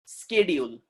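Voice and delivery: schedule with hard sk, "skedule"; American pronunciation. Shown as a demonstration. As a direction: start schedule with a k after the s. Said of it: "American people, they say".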